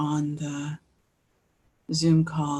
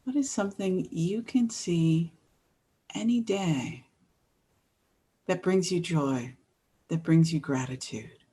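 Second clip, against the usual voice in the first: gentle guiding voice with long pauses; guided meditation. Real, not acted. Host inviting everyone to think about the world around them, guided meditation. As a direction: ease listeners in, leaving space after each phrase.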